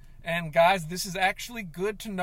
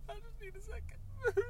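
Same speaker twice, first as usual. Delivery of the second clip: much higher, quiet, breaking into a sob; crying; 12 dB quieter than usual. Acted. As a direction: let the voice crack on the word second. Voice cracks into crying.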